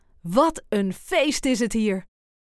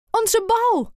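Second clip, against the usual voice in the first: much higher excited cry; calls out; 11 semitones higher than usual. A narrator's reading. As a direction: cry out with longing. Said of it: "roept Sam".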